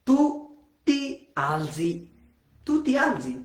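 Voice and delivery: "ti alzi" drawn out very slowly; slow demo. Shown as a demonstration. Teacher conjugating for students: very slow. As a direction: say it slowly for learners.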